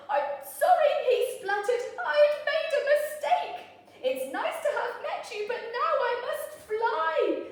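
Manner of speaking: high, loud, laughing panic; frightened dragon. Acted. Scared dragon apologising to the beast, high and loud.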